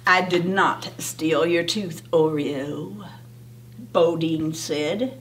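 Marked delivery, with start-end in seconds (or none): 0.0-3.1 low, soft, unbothered little brother; calm, low-voiced denial. A narrator's reading. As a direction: deny it coolly, low and quiet. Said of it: "calmly crawling out from under".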